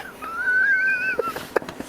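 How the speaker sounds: a high wheezy cackle; high cackling laugh. Real, not acted. Veteran laughing at the prank on Gnarls, with a high cackle. Laughing at his own story.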